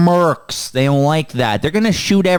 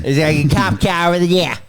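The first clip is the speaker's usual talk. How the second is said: exaggerated Boston accent, dropped r's; huge Boston accent. Mocking the film character's speech, huge Boston accent. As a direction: lay the Boston vowels on thick. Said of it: "Huge Boston accent".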